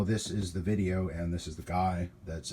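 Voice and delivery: ordinary delivery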